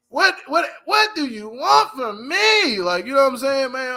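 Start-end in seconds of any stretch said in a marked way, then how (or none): 0.1-2.8 high, drawn-out pleading outburst; slow, raised-pitch singer impression; R. Kelly voice. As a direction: stretch the words high, like an R&B singer. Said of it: "I'm in my R. Kelly voice".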